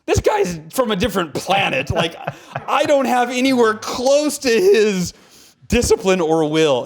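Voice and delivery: awed exclamation. Retold or imitated. Exclaiming awe at Goggins interview.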